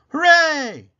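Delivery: high, loud joyful cheer; a loud happy hooray. Acted. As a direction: throw the word up in delight. Cheering at mirror.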